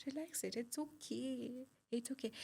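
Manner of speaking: easy, calming meditation-coach voice; soothing. Shown as a demonstration. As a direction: gently coax the body to let go. Coaching the body to relax.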